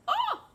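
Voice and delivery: high, mock-theatrical yelp; hammed-up cry, very high and loud; loud dramatic "oh!". Drama kid on knees in the lobby, mock dramatic yelp. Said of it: "as loudly and as dramatically as possible".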